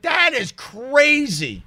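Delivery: high, drawn-out disbelief; incredulous reaction. Retold or imitated. Customer hearing the thirty-one dollar price, incredulous. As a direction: stretch it out, high and amazed.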